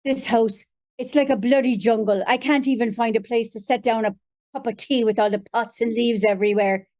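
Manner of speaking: crusty Irish father grumbling; Tom Kelly. Acted. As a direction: complain like a crusty old Irish curmudgeon. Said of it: "jump into Tom Kelly's voice for a moment".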